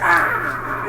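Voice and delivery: high, drawn-out fake scream; imitated wail of agony; mock pain scream. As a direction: act out a wounded "aah". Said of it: "there should be blood spilling from your wound".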